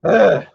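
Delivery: loud, high fed-up "ugh"; exasperated grunt at the game; frustrated groan. Real, not acted. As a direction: groan in disgust. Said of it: "There's my little rant".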